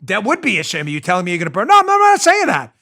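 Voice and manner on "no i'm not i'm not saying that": high, loud wiseguy protest of innocence; mock mob enforcer. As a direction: play the enforcer acting all innocent, much higher and louder. Mob enforcer denying the threat.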